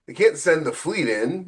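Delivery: ordinary talk, nothing marked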